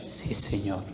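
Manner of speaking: soft, low, submissive answer; small hushed reply; meek assent. Acted. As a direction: answer quietly and humbly, dropping low. Acts the believer's meek answer.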